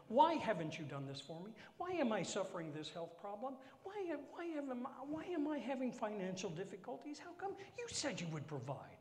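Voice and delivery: high, whining questions; whiny complaint. Retold or imitated. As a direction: whine at God like a child. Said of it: "whiny complaint".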